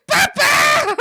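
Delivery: loud high cry; mock shout. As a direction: wail for papa in pretend distress.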